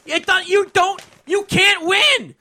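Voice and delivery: ordinary talk, nothing marked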